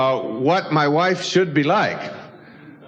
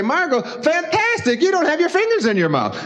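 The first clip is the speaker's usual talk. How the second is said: enthusiastic praise; 6 semitones higher than usual. Retold or imitated. Praising neighbor girl.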